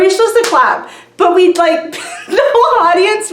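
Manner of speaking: high laughing through words; giggling as it spills out. Real, not acted. Describing the confused crowd, laughing.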